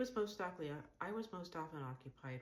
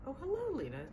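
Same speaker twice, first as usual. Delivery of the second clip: swoops way up high and louder, gushing; high gushing greeting; 13 semitones higher, 7 dB louder than usual. Acted. Tim greeting Lena at the café table, high and gushing. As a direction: greet her like a delighted hostess.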